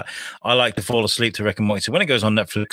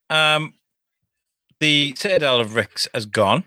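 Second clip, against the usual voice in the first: normal talking voice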